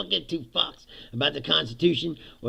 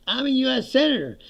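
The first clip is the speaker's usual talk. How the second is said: raised, insistent claim of rank; indignant protest; 8 semitones higher, 5 dB louder than usual. Retold or imitated. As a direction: assert who you are as they grab you. Senator protests being grabbed.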